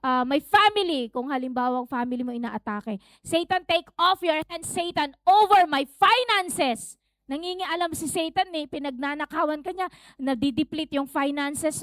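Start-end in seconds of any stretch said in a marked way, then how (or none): 0.1-1.1 loud, high, commanding rebuke; forceful preacher's warfare prayer; strong conviction. Shown as a demonstration. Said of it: "with strong conviction". 3.3-6.9 firm, emphatic rebuke of the enemy; strong conviction. Shown as a demonstration. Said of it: "with strong conviction".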